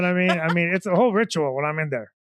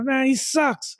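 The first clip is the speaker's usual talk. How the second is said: retold loud jab; 8 semitones higher, much slower than usual. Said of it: "I mean, loud".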